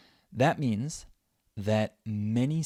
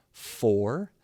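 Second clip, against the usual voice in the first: FOR isolated, long and emphatic; stressed syllable. Shown as a demonstration. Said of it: "The first one is stressed".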